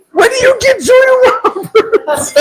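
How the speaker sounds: half-yell it in laughing disbelief; exasperated laughing yell. Retold or imitated. Husband to his wife over the wrong actress, laughing yell. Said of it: "I said".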